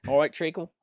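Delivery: high-pitched Essex greeting; Essex accent. Shown as a demonstration. Asked by the interviewer to do Essex, playful Essex accent. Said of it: "Can you do an Essex ac".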